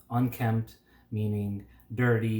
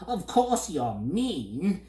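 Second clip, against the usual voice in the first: higher, slow interrupting bird; cutting in bird; 7 semitones higher, slower than usual. A narrator's reading. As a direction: snap in before he finishes. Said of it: "interrupted the bird".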